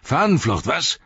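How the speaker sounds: high, loud, jeering challenge; accusing slave-driver outburst; growled accusation. A narrator's reading. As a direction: pounce on suspected deserters. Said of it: "knurrte er".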